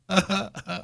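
short high chuckle. Real, not acted. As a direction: laugh at own joke. Laughs at own joke.

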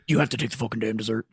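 low, fast imitation of a blown-out throat; Drill Sergeant Stankiewicz. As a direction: do a sergeant wrecked by chain-smoking. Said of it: "his throat was permanently blown out".

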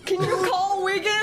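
high, laughing plea; laughing panic. Real, not acted.

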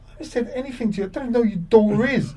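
high, fast, baffled denial; mock-indignant high-pitched reply; incredulous protest. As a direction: protest your innocence, totally bemused. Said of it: "I looked at him, I went".

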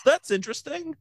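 high, put-on intrigued voice; mock intrigued. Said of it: "I'm like".

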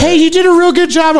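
high-pitched mock encouragement; cheering kid. Mocking kid cooks cheering each other on, chirpy. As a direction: imitate a kid cheering on a rival. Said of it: "the kids all cheer each other on".

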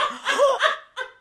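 high retold gasp of shock. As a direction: gasp as if stunned, half laughing. Mimes shock at the child's glare.

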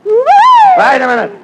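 high, loud, drawn-out objection; indignant protest. Acted. Straight man objecting to his partner, loud and high. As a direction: stretch it out in outrage.

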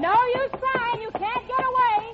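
high-pitched, triumphant call; triumphant taunt. Acted. Girl to the spy she trapped, triumphant taunt. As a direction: crow it high at the captive.

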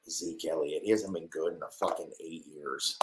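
ordinary talk

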